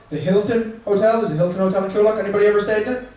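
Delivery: ordinary delivery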